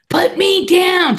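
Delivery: high, loud, slow shout; mock protesting yell. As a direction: imitate a mother hollering as she's lifted. Mother protests being picked up.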